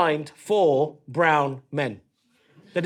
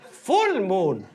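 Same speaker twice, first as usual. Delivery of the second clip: put-on Polish teacher accent. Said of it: "she says".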